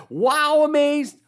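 high, loud, thrilled wow; big amazed wow. Retold or imitated. Preacher imitating Jesus, truly amazed, with a loud high wow. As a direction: gasp out the wow like a jaw-dropping moment. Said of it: "would he be like".

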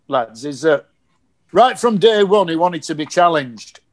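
normal talking voice

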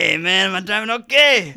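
loud, high mock Mexican stoner accent.

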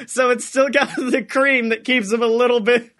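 ordinary talk

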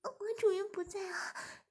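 high anxious pleading; anxious plea. A narrator's reading. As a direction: urgently beg to be freed. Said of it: "志敏则着急地说".

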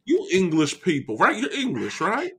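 blustery, swinging Southern cartoon voice; hammed-up Southern rant; Southern gentleman. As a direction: lecture her like an indignant Southern gentleman. Keeps up Foghorn voice.

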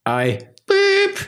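high-pitched censor beep; bleep sound. Pretend. Bleeping out a swear in the quote, high beep. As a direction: squeak out a bleep over the swear. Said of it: "Do I need to bleep it out?".

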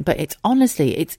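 normal talking voice

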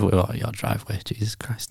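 drifting off far from the mic; a voice fading away from the mic while walking. Real, not acted. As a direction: let the words fade away from the mic. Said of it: "Your voice is going again".

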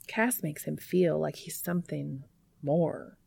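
plain reading voice

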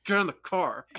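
loud urgent command; urgent yell. Retold or imitated. Sister yelling at sister to get in the car. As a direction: shout it out, hurry them.

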